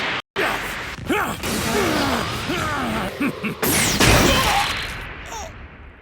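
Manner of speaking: long, high, exultant villain cackle; maniacal villain laugh. Acted. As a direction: laugh wildly in triumph.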